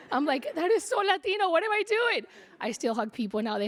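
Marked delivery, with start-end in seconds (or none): 0.5-2.2 self-mocking surprise. Laughing at her own hugging.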